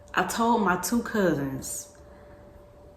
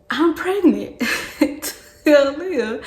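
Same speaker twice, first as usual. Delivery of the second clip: laughing announcement; 9 semitones higher, 7 dB louder than usual. Retold or imitated.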